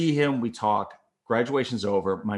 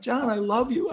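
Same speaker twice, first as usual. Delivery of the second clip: high, strained confession; tight-throated; 13 semitones higher than usual. Retold or imitated. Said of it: "your throat gets tight".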